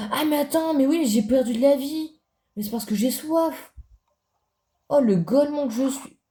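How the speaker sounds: high-pitched, alarmed exclamation; startled realisation. Real, not acted. Gamer noticing lost health, startled and high. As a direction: blurt it out high as it dawns on you.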